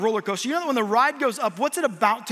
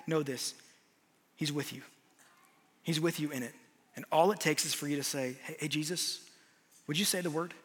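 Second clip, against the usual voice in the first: intimate preaching; 5 semitones lower, 7 dB quieter than usual. Acted. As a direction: lower and softer, tender altar-call voice. Soft altar-call preaching.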